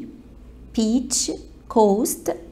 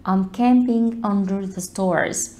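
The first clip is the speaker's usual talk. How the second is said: slow, careful teacher reading; slow model sentence; much slower than usual. Shown as a demonstration. Teacher reads the example slowly to the class. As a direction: spell it out slowly for learners. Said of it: "بتقول".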